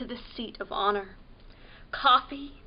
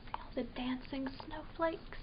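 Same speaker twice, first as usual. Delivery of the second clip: soft, hushed whisper; 13 dB quieter than usual. A narrator's reading. Pointing out snowflakes to a child in a whisper. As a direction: whisper it dreamily.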